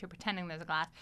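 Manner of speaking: ordinary delivery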